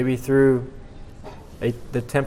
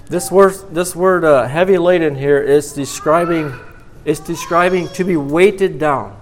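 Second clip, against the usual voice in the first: louder, ringing pulpit emphasis; preacher pressing the weight of the burden; fervent preaching; 3 semitones higher, 4 dB louder than usual. Acted. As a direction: drive the scripture home to the congregation. Preaching with force.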